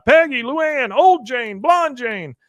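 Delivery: loud, high yelling of names; desperate shouting. Retold or imitated. Husband shouting for his wife over the gate, loud and high.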